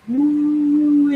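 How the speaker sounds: mock howl rising high; husky locator call. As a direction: imitate the dog's call. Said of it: "starts off real low".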